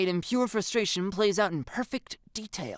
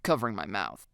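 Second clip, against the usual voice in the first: usual speaking voice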